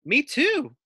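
high little squeaky voice; squeak. Acted. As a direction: squeak it like a tiny pup. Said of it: "squeaks Sophie".

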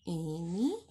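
ordinary delivery

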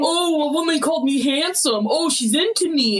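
lightly mock a flattered guy swooning; smitten man. Acted smitten man, flattered by compliment.

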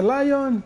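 mock English "Lion"; English-style Lion. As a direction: say "Lion" like the English word instead of León. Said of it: "ella dice".

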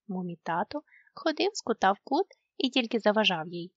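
ordinary delivery, nothing marked

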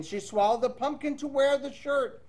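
plain reading voice

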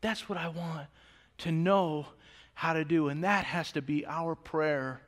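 soft, low turn toward prayer; hushed lead into prayer. Real, not acted. Pastor quieting the church before prayer, soft and low. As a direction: drop down gently to lead the prayer. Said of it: "Let's pray as a church".

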